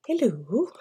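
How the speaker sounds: a hint of a scared young doctor squeaking hello; petrified hello. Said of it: "He's fucking petrified".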